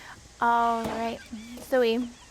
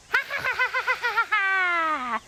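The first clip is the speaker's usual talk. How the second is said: high, loud cackling laugh; witch cackle; 8 semitones higher, 6 dB louder than usual. Shown as a demonstration. Teacher cackling along with the kids, high witch cackle. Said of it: "Do you wanna cackle with me".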